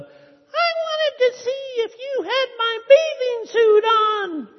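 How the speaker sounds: very high, loud squeaky punchline; mouse yells up. A narrator's reading. Tiny mouse yelling up at the elephant, high squeaky shout. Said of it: "yells up".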